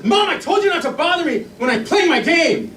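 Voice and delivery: put-on high-pitched child snapping at mom; angry kid. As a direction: imitate a boy losing it at his mother. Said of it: "zero to angry like that".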